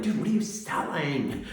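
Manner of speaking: ordinary delivery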